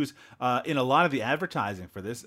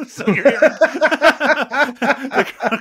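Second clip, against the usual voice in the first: laughing; 12 semitones higher, 13 dB louder, much slower than usual. Real, not acted. Laughing while describing snow sound.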